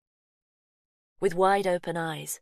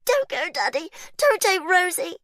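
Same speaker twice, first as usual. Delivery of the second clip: much higher, loud shouting; child's tantrum; 14 semitones higher, 8 dB louder than usual. A narrator's reading. Faith screaming at daddy not to take Rosie. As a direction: scream in desperate panic.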